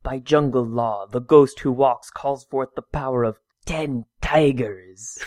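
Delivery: slow, solemn superhero invocation; measured, portentous mantra recital; Phantom. Acted. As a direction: intone it gravely, drawing out the last words. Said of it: "try to get the intonation right".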